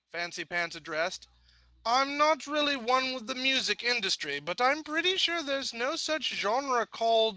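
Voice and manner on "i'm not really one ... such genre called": awkward father. A narrator's reading.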